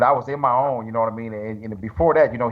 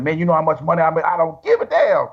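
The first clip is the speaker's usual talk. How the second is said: higher, fast and rising, climbing to a squeak on smoke; heated retold refusal; 7 semitones higher than usual. Telling Chris he won't go to Russia, excitedly. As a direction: ham up the disbelief as it climbs.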